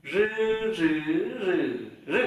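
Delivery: "Rư" buzzed with tongue tip curled, through four Mandarin tones; curled-tongue voiced r. Shown as a demonstration. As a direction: curl up and voice each "rư", changing tone. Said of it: "R cong lưỡi". (đọc "rư" cong lưỡi, rung dây thanh)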